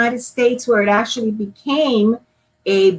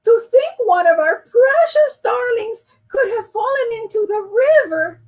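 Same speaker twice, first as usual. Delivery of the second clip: high loud crying lament; mother sobbing over her child; tearful wail; 13 semitones higher, 3 dB louder than usual. Acted. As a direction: cry it out. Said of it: "Mama sobbed".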